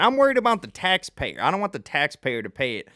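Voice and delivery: put-on lampoon the governor in a Southern accent; mock Southern accent. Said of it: "there's a Southern accent".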